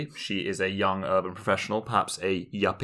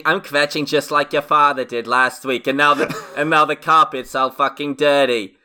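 worried New York mother; 6 semitones higher, 6 dB louder than usual. Retold or imitated. Mother scolding her child Avery, high and loud nagging. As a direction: nag like a kvetching New York mom. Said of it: "maybe the mother is worried".

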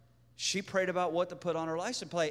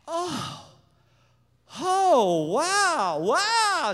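high, swooping wows that build louder; giddy excitement; 8 semitones higher, 5 dB louder, much slower than usual. Retold or imitated. Woman coming round in the chair, giddy excitement. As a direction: gush in mounting high-pitched amazement. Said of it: "She gets all excited".

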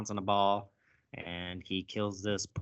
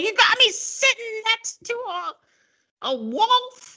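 high squeaky mock voice, louder; 6 dB louder than usual. Squad member outraged about sitting next to a wolf, high squeaky mock voice.

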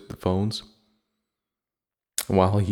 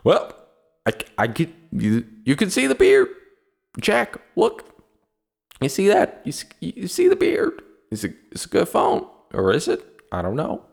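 high, breathy-free mock reviewer voice, much higher and louder; mocking Unbox Therapy reviewer; 10 semitones higher, 5 dB louder than usual. Mocking the YouTuber he unsubscribed from, in a high mock voice. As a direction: mimic the preening tech reviewer showing off his beard. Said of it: "He says".

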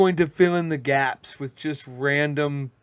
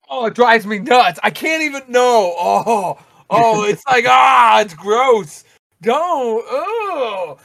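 loud, higher groaning disgust; disgusted outburst; 6 semitones higher, 10 dB louder, slower than usual. Real, not acted. Ranting about lisping speakers, loud and disgusted. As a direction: let the disgust burst out loud. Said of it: "disgust at speech habit".

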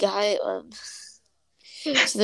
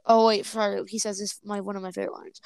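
ordinary delivery

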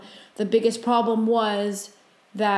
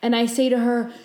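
normal talking voice

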